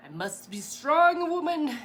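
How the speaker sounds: high, loud, slow mock Viking accent; horrible Viking accent. Psyching herself up in the bakery aisle, hammed-up bad Viking accent. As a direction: ham up a bad Viking warrior woman accent. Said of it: "that's a horrible accent".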